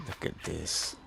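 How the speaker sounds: whispering in quiet awe; soft whisper of wonder; hushed whisper. Real, not acted. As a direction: breathe it out, barely voiced. Awed by pretty game scenery.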